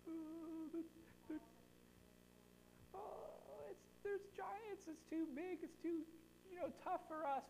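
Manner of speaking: very high, soft, slow frightened voice; scared spies. Retold or imitated. Preacher voicing the spies reporting giants in a high, timid voice. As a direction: quaver like terrified scouts. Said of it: "what do the spies say".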